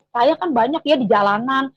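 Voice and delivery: ordinary delivery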